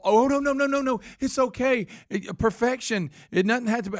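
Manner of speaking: high, cooing mock sympathy; hammed-up soothing judge. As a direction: coddle him in a sugary high voice. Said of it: "And the judges fall all".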